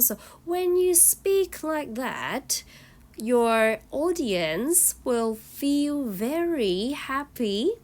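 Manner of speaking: sing-song it so the class stays hooked; lively up and down tune. Shown as a demonstration. Teacher showing the class good lively intonation, swooping up and down. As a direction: pitch swoops up and down on every phrase. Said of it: "So you go up and down and up and down".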